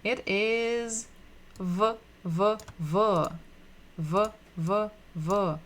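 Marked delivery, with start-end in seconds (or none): 1.6-5.5 buzzed repeated V sound. Shown as a demonstration. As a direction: hold the V sound, buzzing. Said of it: "Makes sound the same as letter B".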